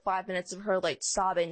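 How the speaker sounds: ordinary delivery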